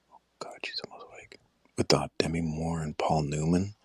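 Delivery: urgent unvoiced whisper. Real, not acted. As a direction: whisper fast, someone is sleeping nearby. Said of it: "I have to whisper a little".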